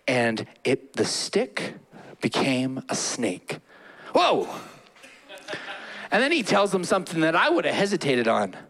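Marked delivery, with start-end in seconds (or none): 4.1-4.5 high startled "Whoa!". Acted. Preacher acting Moses startled by the snake, high. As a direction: jump back in surprise.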